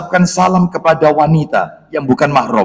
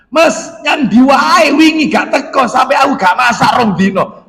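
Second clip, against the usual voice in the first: chatty housewife; 6 semitones higher than usual. Acted. Wife chatting with vegetable seller.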